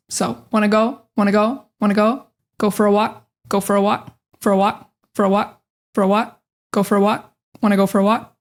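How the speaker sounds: "wanna go", "go for a walk" repeated piece by piece, building to the full phrase; chunked practice. Shown as a demonstration. Teacher drilling phrase chunks for learners. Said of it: "break it up into chunks".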